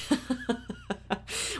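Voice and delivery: soft breathy chuckle; quiet amused laugh; light laugh. Real, not acted. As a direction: laugh at the drama. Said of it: "Aren't we dramatic?".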